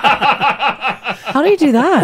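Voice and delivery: laughing through a drawn-out question; amused disbelief. Real, not acted. Host reacts to lobster-eating driver story, laughing disbelief.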